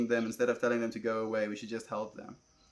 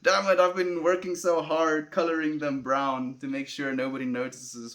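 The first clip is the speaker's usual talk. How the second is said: loud, higher-pitched mock outburst; mock exasperation; 4 semitones higher, 7 dB louder than usual. Streamer joking to viewers that the secret is out, mock exasperation. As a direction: playfully cry out as if caught.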